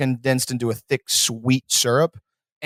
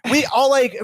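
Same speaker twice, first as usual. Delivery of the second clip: ordinary talk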